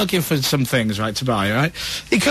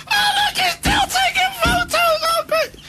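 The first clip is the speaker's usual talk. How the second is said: much higher, a shrill delighted shout; Jill, thrilled. Retold or imitated. Jill calling out to Sophie that he's still snapping, high and thrilled. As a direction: squeal like she's loving the paparazzi. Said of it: "Jill's going".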